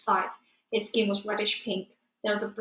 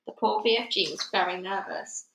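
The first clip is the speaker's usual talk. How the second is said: ordinary delivery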